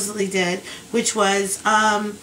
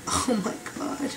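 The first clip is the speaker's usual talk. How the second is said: breathless whispered dismay, much softer; 8 dB quieter than usual. Retold or imitated. Reacting in a whisper to the doctor's pill orders. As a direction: gasp it under your breath.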